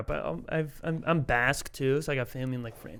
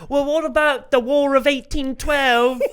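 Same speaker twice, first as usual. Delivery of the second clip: imitating English heckler; 9 semitones higher, 7 dB louder than usual. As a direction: lightly play a smug English heckler. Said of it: "And then he was like".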